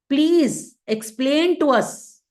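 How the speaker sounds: "Please explain to us" high, slow, every word weighted; stress on each word. Shown as a demonstration. Teacher quoting the sages' request, slow word-by-word emphasis. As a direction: draw out "Please", then lift "explain to us". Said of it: "Why we have to stress on each word".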